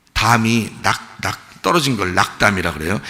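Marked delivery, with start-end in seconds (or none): none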